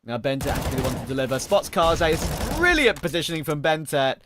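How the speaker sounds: loud, energetic esports casting; play-by-play. Real, not acted. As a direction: call the action live and punchy.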